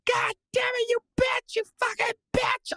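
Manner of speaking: high-pitched reenacted rage scream; furious screaming. Fan cursing at the injury on TV, high furious screaming. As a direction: scream curses at the TV, voice way up. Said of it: "I was screaming".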